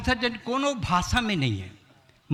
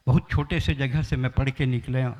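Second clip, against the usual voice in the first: ordinary talk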